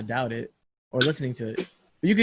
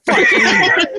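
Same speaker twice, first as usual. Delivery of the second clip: laughing curse; 4 dB louder than usual. Retold or imitated. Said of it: "I was like".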